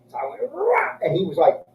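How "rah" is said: high, sharp attack yell; battle yell. Retold or imitated. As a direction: yell like lunging in for the kill. Stabbing bouncer with pen.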